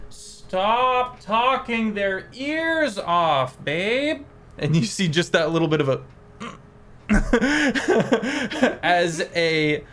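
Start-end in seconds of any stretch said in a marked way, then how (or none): 0.1-4.3 high, slow fairy voice calling across the room; fairy scolding her talkative partner, high and slow. Acted. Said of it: "And then you hear". 7.1-8.8 high, loud giggle in a fairy voice; high fairy giggle entering the room. Acted. Fairy giggles at her partner.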